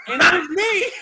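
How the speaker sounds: high, loud incredulous shout; loud comic disbelief; exclaimed punchline. Real, not acted. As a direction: shout it out, breaking into a laugh. Punchline of the wrong-name story.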